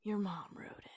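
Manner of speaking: hushed secret whisper; conspiratorial hushed tone. Pretend. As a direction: whisper the reveal. Revealing a secret twist.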